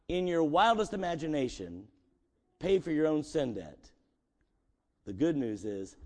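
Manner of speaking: normal talking voice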